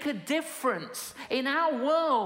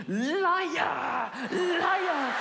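accusing shout; 4 semitones higher than usual. Retold or imitated. Accusing the confessor loudly.

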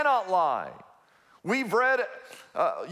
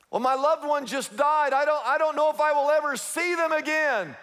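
worried believer; 7 semitones higher than usual. Retold or imitated. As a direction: whine it like a troubled churchgoer. Voicing a grieving listener.

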